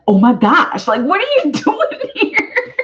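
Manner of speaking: high, giggly "oh my gosh" with a laugh; surprised girl. Pretend. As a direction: squeal it, thrilled to see him. Imagined client surprised by him.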